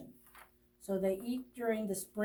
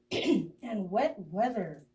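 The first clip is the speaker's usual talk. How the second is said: ordinary delivery, nothing marked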